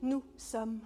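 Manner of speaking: stage actor's big delivery; theatrical monologue. Acted. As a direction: declaim it grandly, loud. Theatrical monologue of a personified fate.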